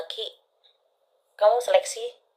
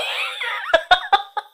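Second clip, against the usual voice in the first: high shrieking laugh; squealing laughter; loud laugh; 3 dB louder than usual. Real, not acted. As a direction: burst out laughing. Bursts laughing after the title.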